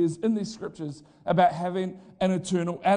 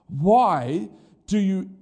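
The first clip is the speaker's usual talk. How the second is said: slow emphatic reading; 4 dB louder, much slower than usual. Acted. Reading scripture dramatically.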